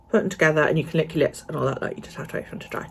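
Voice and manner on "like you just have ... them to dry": whispering. Real, not acted. Quick unvoiced aside.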